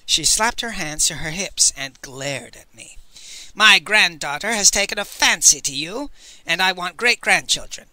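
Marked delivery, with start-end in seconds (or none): 3.5-7.9 loud, domineering snarl; snarling matriarch. A narrator's reading. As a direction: snarl the demand like a tyrant. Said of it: "she snarled".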